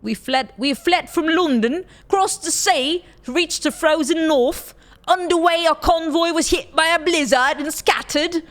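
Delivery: fake British accent. Streamer reading the game's story in a fake British accent. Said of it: "worst fake British accent in the world".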